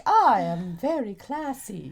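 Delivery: slow, classy transatlantic accent. Shown as a demonstration. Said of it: "transatlantic is like".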